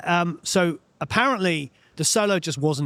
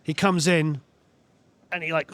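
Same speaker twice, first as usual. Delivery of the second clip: ordinary talk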